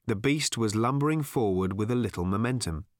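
plain reading voice

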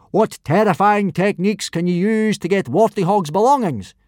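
loud, high barked instructions; yelling. A narrator's reading. Teacher assigning roles to pupils, yelling. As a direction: bellow the orders out like a drill. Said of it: "yelled Gobber".

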